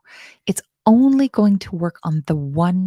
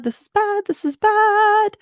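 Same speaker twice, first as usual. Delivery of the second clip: squeaky voice; 13 semitones higher than usual. Acted. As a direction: whine it in a tiny voice. Repeated in odd voice.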